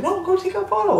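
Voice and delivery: lightly voiced, high insistent push; hint of an eager publicist; pushy friend. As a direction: urge him on, a touch mock.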